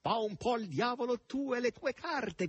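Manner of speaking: high, brash yell; laughing shout. A narrator's reading. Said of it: "gridò Natascia, ridendo sguaiatamente".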